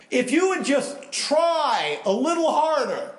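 high, slow, patronising sing-song; mocking advice. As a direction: talk down to a drowning man in a sneering high voice. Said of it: "what are you gonna say".

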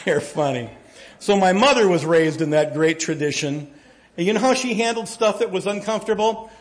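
ordinary talk

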